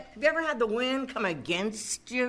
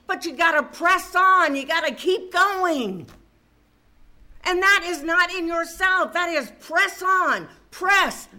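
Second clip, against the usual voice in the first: raised, ringing preacher's exhortation; preaching at full force; 5 semitones higher than usual. Acted. Preacher urging the church to press on to the prize, full-force preaching.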